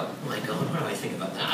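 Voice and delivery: low, hushed mock dismay; dismayed aside. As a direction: drop it down as a worried thought. Acted private reaction to awkward call.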